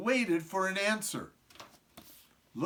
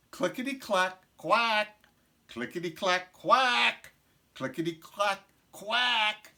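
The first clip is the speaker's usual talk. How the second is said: typing and quacking; 3 semitones higher than usual. A narrator's reading. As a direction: quack like a duck between clacks.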